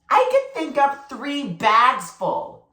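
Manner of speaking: put-on high, slow little-boy boast; a proud four-year-old showing off, drawn out and high. Small brother boasting to the older kids, high and slow.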